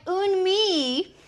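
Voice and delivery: "Un mi" with the L dropped, said "mee"; mock wrong French. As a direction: clip "mi" short, no L sound at all. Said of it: "and then I was like".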